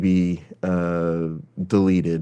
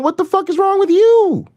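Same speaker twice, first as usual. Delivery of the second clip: exasperated high mock yell. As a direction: shriek at them like a fed-up liberal.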